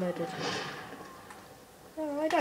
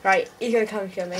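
normal talking voice

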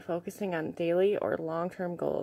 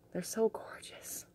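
soft, slow, awed whisper; 4 semitones higher, 8 dB quieter, slower than usual. Real, not acted. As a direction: breathe it out in awe. Whispered admiration of stone.

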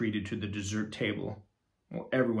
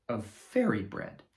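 plain reading voice

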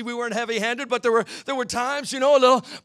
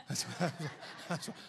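normal talking voice

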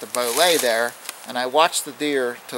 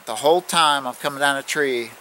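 ordinary talk, nothing marked

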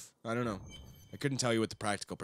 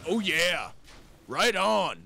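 loud, higher-pitched hero catchphrases; Aquaman; 6 semitones higher, 4 dB louder, much slower than usual. Retold or imitated. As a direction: punch out each short catchphrase. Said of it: "he only speaks in, like, two words".

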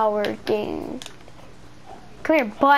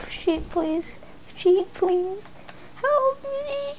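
higher, soft tiny begging voice; mock pleading voice; 7 semitones higher, 4 dB quieter, much slower than usual. Gamer begging a sheep in a tiny voice. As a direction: squeak it like a helpless critter.

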